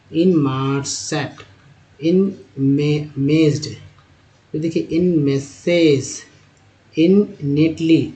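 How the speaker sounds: each word drawn out, slowly; slow syllable reading. Shown as a demonstration. Teacher reading new words to students, slow and deliberate. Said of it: "reading word list slowly".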